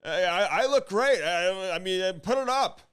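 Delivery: hammed-up Cam insisting he looks great; mock vain brag. Cam boasting to Andy about the video, mock vain brag. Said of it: "Cam's like".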